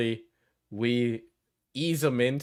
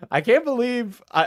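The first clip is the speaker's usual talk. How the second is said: laughing disbelief; 10 semitones higher, 4 dB louder than usual. Real, not acted.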